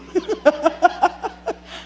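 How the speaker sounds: una risa breve al recordarlo; risa real. Real, not acted. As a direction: se ríe de su propia queja. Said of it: "cómo me da chiste". (risa espontánea al recordar el robo)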